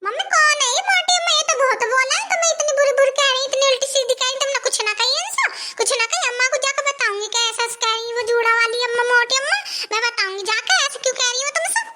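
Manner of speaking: put-on high, childish little girl voice. Little daughter in the fields asking mummy to play, high child voice. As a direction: sound like a small girl begging her mummy.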